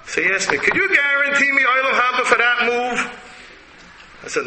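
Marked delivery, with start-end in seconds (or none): 0.6-3.1 put-on old rabbi voice. Voicing his Rebbe's reply.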